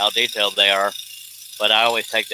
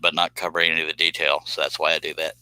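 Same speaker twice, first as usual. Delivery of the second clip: usual speaking voice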